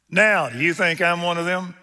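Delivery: high, loud indignant challenge; cursing retort. Retold or imitated. Preacher voicing Peter cursing his accuser, indignant retort. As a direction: throw it back as a heated retort. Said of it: "He cursed him".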